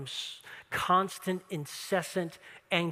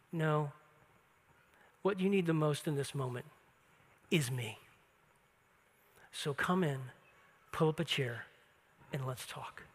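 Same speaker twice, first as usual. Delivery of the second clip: tender invitation; 5 semitones lower, 6 dB quieter than usual. Retold or imitated. Jesus gently invites Martha in.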